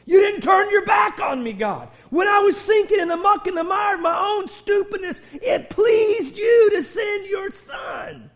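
loud high joyful cry to God; ecstatic shout of praise. Acted. A saved sinner crying out his joy to God. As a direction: shout it out, overwhelmed with thanks. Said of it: "we oughta be going".

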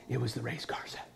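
secret hushed whisper; child peeking at a gift, whispering. Acted.

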